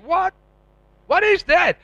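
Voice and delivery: high, loud disbelief; baffled father. Retold or imitated. Father on the phone hearing the news, high and baffled. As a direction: react as if it makes no sense.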